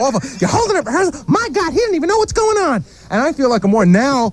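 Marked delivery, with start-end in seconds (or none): 0.4-2.8 much higher, mock scolding voice in a put-on timbre; Robin mocking scold. [name] mimics Robin scolding him at rehearsal, higher mock tone. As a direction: lampoon her shrill put-down, higher. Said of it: "She goes".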